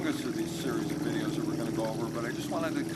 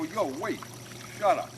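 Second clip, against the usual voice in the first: gruff father ordering; 3 semitones higher than usual. Retold or imitated. Father breaking up his daughter's argument with her boyfriend, ordering sternly.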